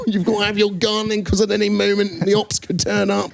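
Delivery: hammed-up comic presenter, pushing the joke; Michael McIntyre impression. Riffing on the host's gangland narration voice. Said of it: "That was a good McIntyre impression".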